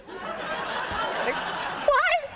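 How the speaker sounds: high, breathless laughing through the words; can't stop laughing while asking; squeaky laugh of disbelief. Real, not acted. Laughing at doctor's advice.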